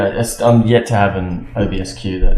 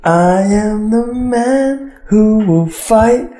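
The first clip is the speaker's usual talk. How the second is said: much higher, very slow, grand; heroic voice; 10 semitones higher, much slower than usual. Pretend. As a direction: declaim like a gallant hero. Grandiose sign-off line.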